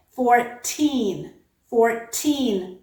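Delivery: put-on "fourteen" with a crisp t and long stressed TEEN; second syllable stressed. Shown as a demonstration. Teacher contrasting two words, "fourteen" stressed on TEEN with true t. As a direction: hit TEEN in "fourteen", no flap. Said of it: "absolutely does not change".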